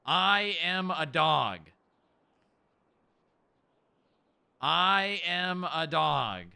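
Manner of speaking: slow, deliberate words for the dog to copy; slow model phrase. Shown as a demonstration. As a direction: spell it out slowly, word by word. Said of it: "Say it with me".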